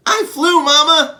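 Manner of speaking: put-on high, loud, bubbly child gorilla voice; a thrilled little kid after a ride. Baby gorilla Kudzu thanking the elephants, high and thrilled.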